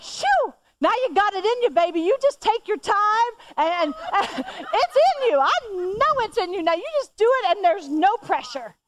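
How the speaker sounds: high, sing-song coaxing; sweet encouragement. Retold or imitated. Mom suddenly sweet and encouraging.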